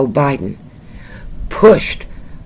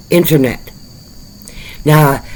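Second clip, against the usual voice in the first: normal talking voice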